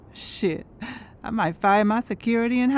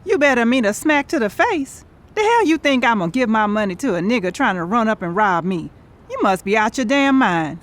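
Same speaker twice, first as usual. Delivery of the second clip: heated, incredulous telling-off; indignant scolding; 3 semitones higher, 4 dB louder than usual. Acted. Woman refusing to pay her would-be robber, indignant scolding. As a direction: tear into your friend for the crazy idea. Outraged at giving robber money.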